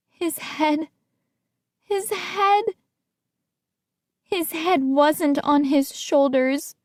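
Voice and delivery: rising, breathless horror, slowing down; horrified. A narrator's reading. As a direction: choke it out in disbelief. Horror building on repeated words.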